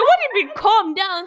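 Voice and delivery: high loud indignant outburst; laughing outraged protest. Real, not acted. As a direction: fume at the nurse, loud and high. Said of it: "outraged retelling".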